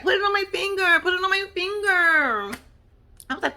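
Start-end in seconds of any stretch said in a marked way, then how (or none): 0.0-1.0 much higher, eager girlish mock plea; mock Emily. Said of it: "she's like". 1.0-2.6 high, drawn-out mock plea; mock Emily, slower. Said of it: "she's like".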